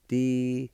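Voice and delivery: Di-se-sti said syllable by syllable, each part clear; careful pronunciation demo. Shown as a demonstration. Teacher modelling Di-se-sti for students, careful demo. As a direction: sound out Di-se-sti in three clear beats. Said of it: "First part is pronounced like this".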